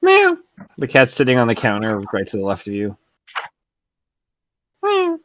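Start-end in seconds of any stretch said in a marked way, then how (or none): 0.0-0.4 high cat meow. Acted. GM voices the cat meowing. 4.8-5.2 high little meow; cat meow. Acted. GM voices the cat meowing.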